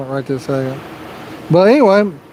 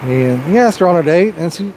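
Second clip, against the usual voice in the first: ordinary talk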